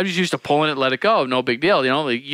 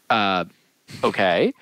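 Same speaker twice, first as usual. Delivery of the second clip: hesitant acceptance; 8 semitones lower, slower than usual. Retold or imitated. Taken aback by Tom refusing gas.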